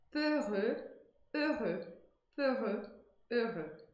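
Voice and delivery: "peureux, heureux" alternating with closed rounded E; "peureux" and "heureux" with lips in a small pout; French peureux and heureux. Shown as a demonstration. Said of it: "a boca tem que tá com esse biquinho". ("peureux, heureux" com biquinho)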